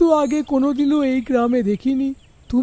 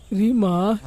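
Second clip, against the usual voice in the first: ordinary delivery, nothing marked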